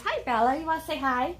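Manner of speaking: sweet coaxing voice to a pet dog; coaxing pet talk. Real, not acted. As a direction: coax the pup to say hi.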